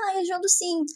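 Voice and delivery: sweet dad reply. Retold or imitated. Father answering sweetly.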